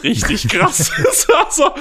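words breaking up into high laughter; delighted laugh through the words. Real, not acted. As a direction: crack up while saying it, pitch shooting up. Breaks into laughter recalling the show.

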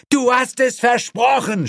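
high-pitched cry in protest; shouting. A narrator's reading. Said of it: "schrie er". (schreiend, hohe Stimme)

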